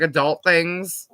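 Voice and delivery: normal talking voice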